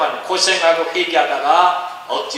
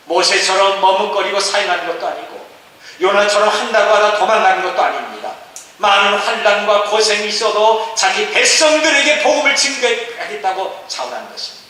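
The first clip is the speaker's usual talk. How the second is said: raised, forceful sermon voice; emphatic preaching; 7 semitones higher, 6 dB louder than usual. Acted. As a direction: drive the contrast home to the congregation. (힘주어 강조하는 설교)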